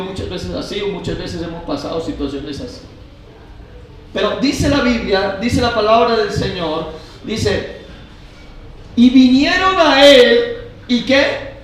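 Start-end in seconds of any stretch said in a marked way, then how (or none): 4.1-11.5 raised, forceful sermon voice; preaching. Real, not acted. Pastor telling the congregation of the boat in the storm, preaching. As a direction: preach it out loud and high to the whole room.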